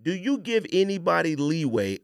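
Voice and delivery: ordinary talk, nothing marked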